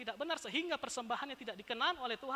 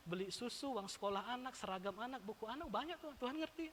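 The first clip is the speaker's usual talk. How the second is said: wheedling churchgoer; 6 semitones lower, 11 dB quieter than usual. Retold or imitated. Mimicking a stingy churchgoer's excuses.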